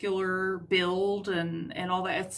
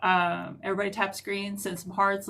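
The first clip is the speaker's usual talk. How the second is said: ordinary talk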